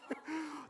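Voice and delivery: soft high giggle. Real, not acted. Telling the peanut butter poo story, soft high giggle.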